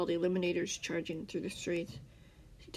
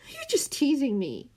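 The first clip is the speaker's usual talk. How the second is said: higher whiny complaint; complaining; 7 semitones higher, 4 dB louder than usual. A narrator's reading. Scar complaining to his captor, higher and whiny. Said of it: "Scar complained".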